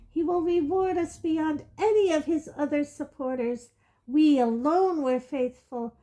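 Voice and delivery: defiant witch. Acted. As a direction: proclaim it proudly, unafraid.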